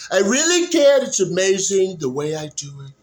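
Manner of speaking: high, loud boastful Trump voice, settling down; Trump impression. Vlogger practicing impressions, doing boastful Trump. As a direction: brag like Trump with a big opening. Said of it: "I could go straight into Trump".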